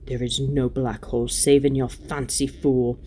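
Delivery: plain reading voice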